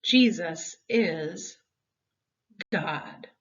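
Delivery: ordinary delivery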